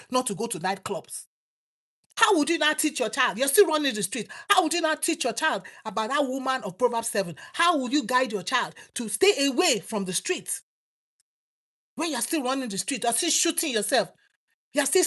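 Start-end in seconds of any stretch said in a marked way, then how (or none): none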